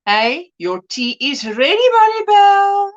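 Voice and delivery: a hammed-up call across the house; playful call. Calling Maribel for tea.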